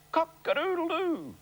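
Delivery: high crowing cock-a-doodle-doo; rooster crow. Retold or imitated. Imitating rooster in book.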